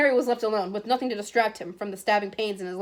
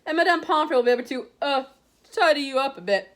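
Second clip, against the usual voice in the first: lightly voiced old headmaster, higher; a gentle, amused elder wizard; kindly headmaster; 5 semitones higher, 3 dB louder than usual. A narrator's reading. Headmaster sending Harry to the hospital wing, lightly voiced.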